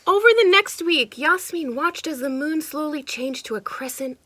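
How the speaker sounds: lively storybook narration; animated read-aloud for kids; reading to children. A narrator's reading. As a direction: read it like a picture book to a child. Reading picture book aloud.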